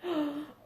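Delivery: staged gasp of alarm; mock gasp. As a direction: gasp for the kids. Dragon about to breathe fire.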